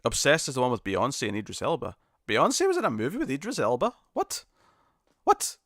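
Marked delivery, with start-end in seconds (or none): 2.3-5.6 high, baffled questioning; incredulous. Real, not acted. Streamer hearing [name] acted with [name], incredulous. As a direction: squeak out each what in disbelief.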